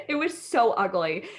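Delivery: ordinary delivery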